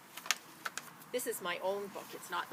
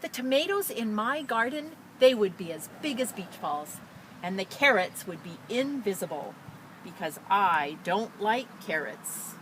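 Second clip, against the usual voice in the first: lively, louder storybook reading; expressive picture-book read-aloud; reading to children; 3 semitones higher, 11 dB louder than usual. Acted. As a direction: read it out brightly to a class. Teacher reads picture book aloud.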